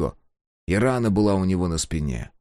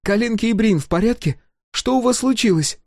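high, worried questions; anxious Sol; 3 dB louder than usual. A narrator's reading. Sol asking the ghost about friends, high and anxious. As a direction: ask quickly and anxiously.

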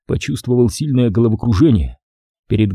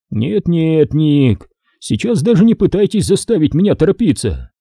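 higher, mocking older major; a sly, indulgent Stone; Stone's silky voice; 6 semitones higher than usual. A narrator's reading. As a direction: voice Stone teasing his partner.

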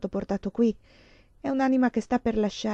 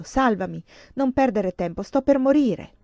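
urgent plea; 4 semitones higher, 5 dB louder than usual. A narrator's reading. Dying woman begs to be saved.

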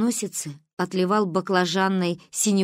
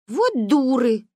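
disdainful exclamation, slightly raised; scornful; 5 semitones higher, 3 dB louder, slower than usual. A narrator's reading. Sister disgusted by the tattling girls, scornful. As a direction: toss it out with disgust. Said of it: "скривилась Каринка".

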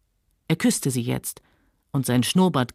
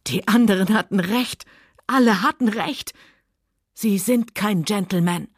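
angry; 3 semitones higher than usual. Acted. Scarlett accuses Rhett after slapping.

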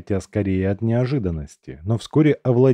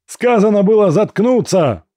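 much higher, much louder roaring yell; furious yell; 11 semitones higher, 8 dB louder than usual. A narrator's reading. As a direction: scream it at the prisoner in rage. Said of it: "заорал командир".

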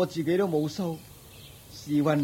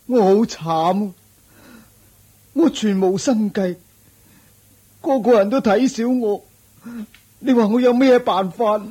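loud, high, despairing complaint; wailing lament to the Buddha; anguished lament; 4 semitones higher, 6 dB louder than usual. Acted. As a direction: pour out your misery, voice straining.

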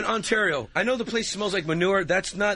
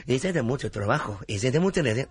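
Martinito; 5 semitones lower than usual. Acted. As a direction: lower Spanish-accented character voice. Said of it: "They call him Martinito".

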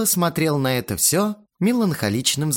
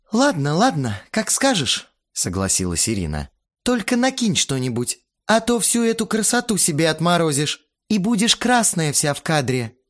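higher, placating woman; yielding organizer voice; 8 semitones higher than usual. A narrator's reading. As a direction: give in, then fuss. Irina giving in.

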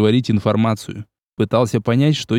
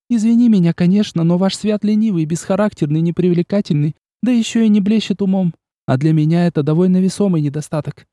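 put-on higher, haughty young woman; Olga's voice; 6 semitones higher than usual. A narrator's reading. Olga rejecting the prince's brother, haughty. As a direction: voice Olga, proud and cutting.

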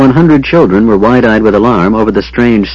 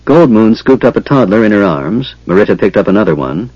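ordinary delivery, nothing marked

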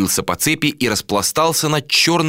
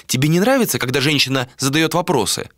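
higher female character voice; challenging woman; 6 semitones higher than usual. A narrator's reading. Woman questions captain's dislike, higher challenging voice. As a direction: challenge him with raised pitch.

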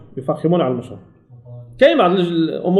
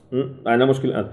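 ordinary talk, nothing marked

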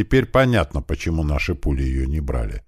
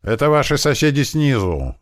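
higher-pitched hollered announcement; sudden yell; 9 semitones higher, 4 dB louder than usual. A narrator's reading. Posing as downstairs neighbours at the door, sudden yell. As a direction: yell it through a closed door. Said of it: "неожиданно заорал Кирпич".

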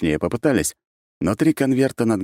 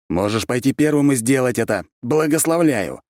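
raised, sarcastic bark; barked retort; 8 semitones higher, 4 dB louder than usual. A narrator's reading. Archmage answers the objecting colleague, barking. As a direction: snap back with rising pitch. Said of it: "рявкнул я".

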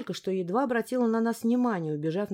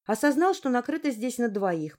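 plain reading voice

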